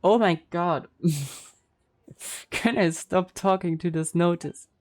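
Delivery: usual speaking voice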